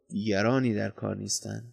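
low, softened restatement; quiet repetition. A narrator's reading. Companion repeats his answer as they walk on, low and quiet. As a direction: repeat it softly, dropping the voice.

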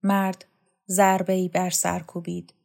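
usual speaking voice

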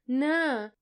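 hurt captive groans in protest; moaning no. A narrator's reading. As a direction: groan it out while held down. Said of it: "ناله کرد".

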